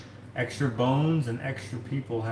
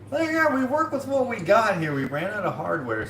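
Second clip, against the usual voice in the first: high-pitched, louder put-on worker voice; carnival worker; 11 semitones higher, 3 dB louder than usual. Carnival worker explaining a makeshift ride, high put-on voice.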